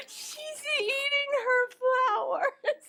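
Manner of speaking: high laughing line. Real, not acted. Amused at tortoise eating her bouquet, laughing. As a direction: laugh through it.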